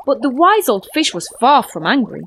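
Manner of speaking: usual speaking voice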